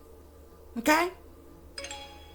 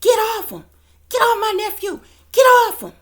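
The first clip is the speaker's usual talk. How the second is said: high, loud mock scream of get off him; woman yelling to stop a fight; imitating Jaguar screaming; 10 semitones higher, 6 dB louder than usual. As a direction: shriek it like she's pulling someone off. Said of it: "you can hear Jaguar saying".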